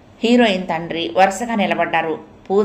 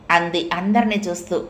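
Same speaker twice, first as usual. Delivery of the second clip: ordinary delivery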